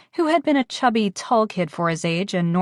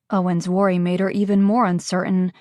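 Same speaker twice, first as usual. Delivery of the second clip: ordinary delivery, nothing marked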